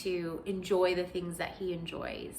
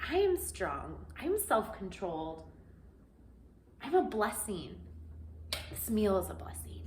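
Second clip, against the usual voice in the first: affirmations; 6 dB quieter than usual. Retold or imitated. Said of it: "and then I'm like".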